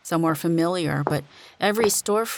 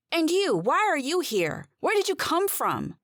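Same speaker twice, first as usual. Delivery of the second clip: demanding; 11 semitones higher than usual. Acted. Demanding answers from strange clerk.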